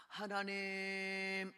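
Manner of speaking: long, held-out call to God; drawn-out prayer call. Retold or imitated. Frightened believer starts praying alone at night, drawn-out call. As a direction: stretch the word out, on one level note.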